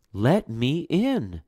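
drawn-out high plea; slow pleading call. Acted. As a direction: call it out slowly, begging. Mother calling at door, fourth time.

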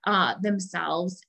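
ordinary delivery

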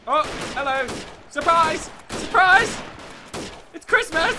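high, loud surprise greeting; mock-cheery shouting. Gamer ambushing enemies with Christmas greetings, high excited shouts.

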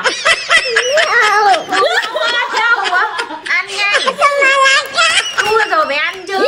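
high playful shout; teasing urging. Real, not acted. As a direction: egg him on, laughing. Urging the loser to eat lemon.

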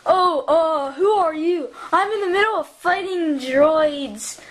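usual speaking voice

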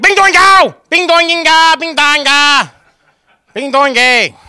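high, loud mock gunshot noises; gunfire noises. Kid firing a pretend gun at his dad, loud high mock gunshots. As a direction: shout out play gun sounds. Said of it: "so I'd be like".

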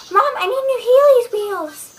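put-on chirpy child asking mom; little cousin's voice. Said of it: "my little cousin was like".

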